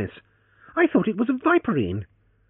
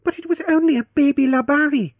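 high, loud reproach, ONLY stressed; girl reproaches the hero, stressing only; reproachful; 6 dB louder than usual. Acted. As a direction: chide him for the fuss over "only". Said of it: "she reproached him".

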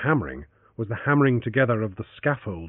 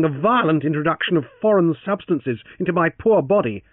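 raised, loud mock-cheerful banter; Francis, wry and mocking; 9 semitones higher, 5 dB louder than usual. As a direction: tease the gunmen with high, loud bravado. Francis mocking the armed riders.

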